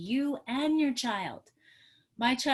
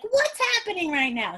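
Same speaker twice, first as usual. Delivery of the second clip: high, loud, goofy mock question; silly clowning; 9 semitones higher, 6 dB louder than usual. Parent turning to upset child in back seat, silly. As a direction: clown it up to get a giggle. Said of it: "I made the funniest face, like".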